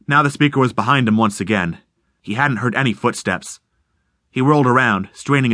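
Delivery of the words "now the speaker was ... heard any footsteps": plain reading voice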